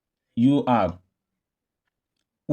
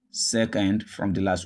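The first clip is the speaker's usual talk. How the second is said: ordinary talk, nothing marked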